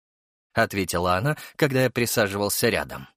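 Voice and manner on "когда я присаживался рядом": ordinary delivery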